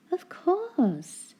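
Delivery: high, bright burst of realisation; sudden exclaim. Acted. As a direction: pop out the idea with delight. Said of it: "exclaimed Missus Grinling".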